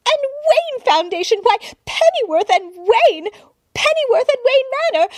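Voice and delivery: an admirer who can't contain herself; gushing society woman. A narrator's reading. Society woman gushing at the butler, high and loud. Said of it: "the woman continued to gush".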